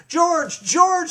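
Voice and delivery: high-pitched urgent call; shouting nurse. A narrator's reading. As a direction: call out his name like the nurse. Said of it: "she shouted".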